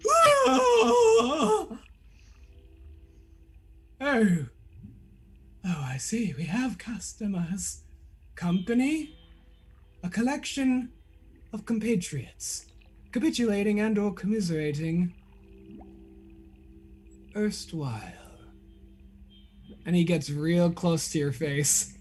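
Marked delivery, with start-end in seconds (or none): 0.1-1.8 high squeaky startled cry; sudden high yelp of surprise. Acted. Character startled face to face. 4.0-18.1 lightly voiced eccentric old man, softer and musing; a hint of a theatrical eccentric, quiet and wordy; eccentric Tinby.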